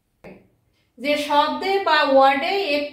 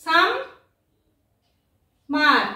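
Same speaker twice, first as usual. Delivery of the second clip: সাম, মার, each syllable on its own; split into syllables; slower than usual. Shown as a demonstration. Teacher showing two syllables, split apart with long pauses. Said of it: "দুটি শব্দাংশ আছে".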